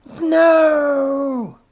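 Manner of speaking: high, loud despairing cry; anguished cry. A narrator's reading. Professor crying out as his robot smashes, loud and high. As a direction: howl it in horror. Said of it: "cried Professor von Stink".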